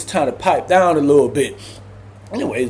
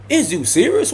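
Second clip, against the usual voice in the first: disbelieving; 8 semitones higher than usual. Retold or imitated. Disbelief at cellmate's rule.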